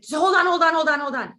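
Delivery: urgent hold on. Retold or imitated. Retells stopping the nurse urgently.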